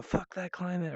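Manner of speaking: hammed-up high defiant outburst; imitating Greta. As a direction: play Greta shouting defiantly at the world. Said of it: "she just said".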